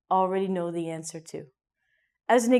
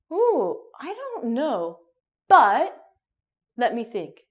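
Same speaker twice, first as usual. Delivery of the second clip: thoughtful hesitation; 3 semitones higher, 3 dB louder, slower than usual. Shown as a demonstration. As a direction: stretch it out while buying time. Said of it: "you're going to do things like this".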